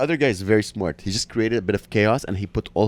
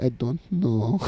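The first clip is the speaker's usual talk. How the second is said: tiny Spanish lady; 2 semitones higher, 5 dB quieter, slower than usual. Retold or imitated. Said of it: "Spanish lady".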